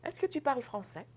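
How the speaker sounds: "Est-ce que tu parles français?" with a high, lifting start; a bright question lilt; question tune going up. Shown as a demonstration. Teacher modelling the question tune for the class. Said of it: "you go up".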